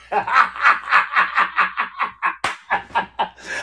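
long, rhythmic cackle; hearty laughter. Real, not acted. Relieved they didn't mimic the accent on the call, laughing hard.

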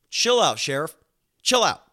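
high, loud call to a dog. Retold or imitated. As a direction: sharply tell a restless dog to settle. Telling panting dog to calm down.

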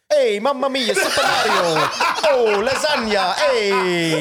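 hammed-up Italian with a laugh; mock Italian. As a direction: belt out cartoon Italian words, laughing. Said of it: "and he went".

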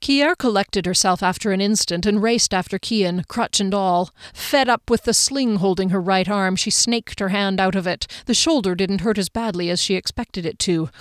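ordinary delivery

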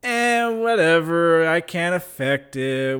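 slow, stretched-out "whatever" voice; imitated indifference. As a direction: imitate people who can't be bothered. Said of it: "they just kinda go".

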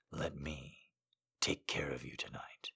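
low, soft, seductive man; seductive whisper. Acted. Seductive male voice.

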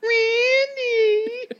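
high, loud call of the name; calling Randy. Pretend. Friends mimicking the navigation voice, high loud call. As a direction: hoot the name out like calling a buddy.

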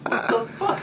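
high shocked outburst; outraged disbelief. Real, not acted. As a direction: shout it in disbelief. Outraged at the movie.

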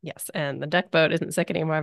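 ordinary talk

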